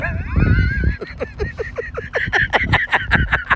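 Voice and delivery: very high squealed laugh; a wild, high-pitched laughing squeal; shrieking laugh. Real, not acted. Squealing laugh after joke.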